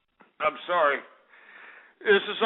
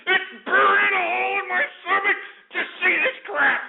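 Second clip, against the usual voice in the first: anguished shout; 8 semitones higher than usual. Real, not acted. Ranting host repeats, still shouting.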